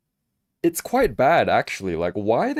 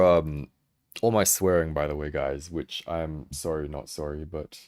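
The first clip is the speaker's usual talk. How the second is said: ordinary delivery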